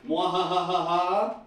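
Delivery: slow, drawn-out evil cackle; supervillain laugh. Shown as a demonstration. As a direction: cackle like a cartoon villain. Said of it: "supervillains laugh like that".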